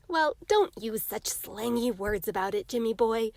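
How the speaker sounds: usual speaking voice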